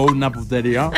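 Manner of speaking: laughing mock praise. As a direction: pretend it's delicious while laughing. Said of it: "Y yo". (elogio fingido entre risas, más suave)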